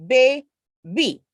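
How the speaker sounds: put-on "Baby" as bay, gap, bee; two clearly separated beats in "Baby", the first rising; split into syllables. Shown as a demonstration. Teacher answering two syllables, "Baby" split in two. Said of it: "Two syllables".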